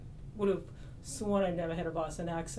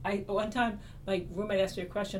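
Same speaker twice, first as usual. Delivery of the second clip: usual speaking voice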